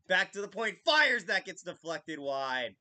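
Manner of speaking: loud hockey announcer call; play-by-play. Acted. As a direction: call the shot like a broadcaster. Mock play-by-play calling shot.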